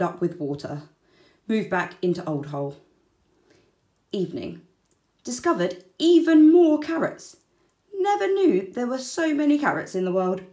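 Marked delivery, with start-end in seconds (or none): 5.2-10.4 higher, much louder, gleeful; thrilled discovery. A narrator's reading. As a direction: burst out delighted about carrots. Wombat finds carrot source.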